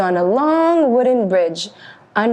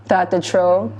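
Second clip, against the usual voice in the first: ordinary delivery, nothing marked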